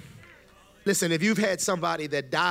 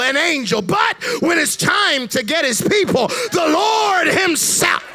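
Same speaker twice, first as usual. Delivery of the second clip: rising, louder and louder, pulpit-pounding; full-force preaching; 4 semitones higher, 6 dB louder than usual. Acted. Preacher to congregation about Christ's return, building to a roaring shout. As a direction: preach it to the back row, climbing to a shout.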